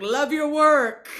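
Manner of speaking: drawn-out, punched-up casual praise; breezy called-out compliment. Retold or imitated. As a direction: call it out slowly to a big star. Quotes self greeting movie star.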